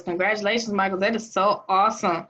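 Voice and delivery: ordinary talk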